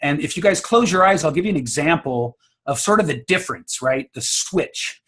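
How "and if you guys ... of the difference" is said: ordinary talk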